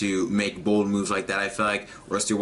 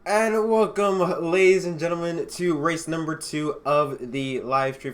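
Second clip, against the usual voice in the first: announcer welcome; 6 semitones higher than usual. Acted. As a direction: belt it out like a race-day PA announcer. Host opens the race broadcast.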